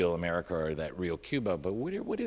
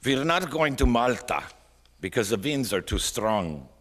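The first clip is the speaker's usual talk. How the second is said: put-on loud, raised Norwegian-accented captain; 6 semitones higher, 7 dB louder than usual. Captain announcing to passengers, in a Norwegian accent. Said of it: "I'll try to do my best Norwegian captain accent".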